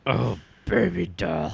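a lightly voiced crocodile villain; Killer Croc voice. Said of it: "your best Killer Croc impression".

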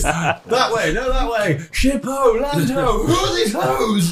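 sailor shouting orders. Acted. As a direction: yell across the deck like a sailor. Mock ship crew shouting orders.

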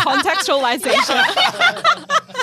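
the word bursts out high through real laughter; laughing. Real, not acted. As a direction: say it while still cracking up at the joke. Laughing at a joke about tigers.

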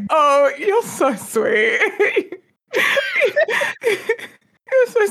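high, drawn-out coo with laughter; cooing adoration. Acted. Fan talking to a clueless character on screen, cooing adoringly. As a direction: gush as if at an adorable puppy. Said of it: "I'm like".

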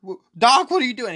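high, loud retold yell; imitated panicked yelling. Krug yelling at John on waking, high imitated yell. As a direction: act out a scared man yelling at his captor. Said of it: "He starts yelling and screaming".

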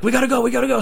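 high-pitched acted urgency; panicked urging. Friends urging escape, acted.

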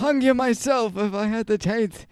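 put-on loud, vengeful old man voice; hammed-up old man indignation. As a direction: threaten as a mock-furious elderly man. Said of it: "very good old man accent".

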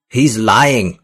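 loud, high accusing shout. A narrator's reading. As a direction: yell the accusation. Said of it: "Vargas shouted".

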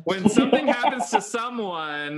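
high, loud, laughing as he repeats it; laughing through words. Real, not acted. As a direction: laugh your way through the line. Repeats cue while laughing.

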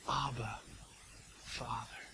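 soft, intimate murmur; low, hushed prayerful call; hushed tender Abba. Acted. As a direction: breathe it like a child to a dad. Quoting the cry to God.

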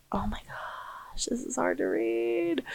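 breathy whisper to herself, slow; hushed exasperated aside. Real, not acted. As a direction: whisper it under your breath. Aside while struggling to read.

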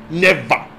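ordinary talk

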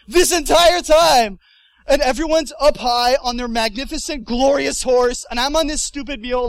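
loud, high, worked-up rant; hammed-up aggrieved outburst; comic exasperation. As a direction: complain loudly about the indignity. Ranting about the mule ride.

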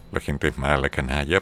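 ordinary talk

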